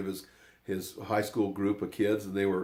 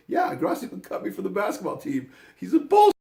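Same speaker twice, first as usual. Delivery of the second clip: high-pitched outburst building louder; imitated indignation; 11 semitones higher, 7 dB louder than usual. Cut player venting to Coach Story, indignant. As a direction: complain hotly, peaking on the last words.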